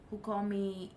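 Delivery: ordinary talk, nothing marked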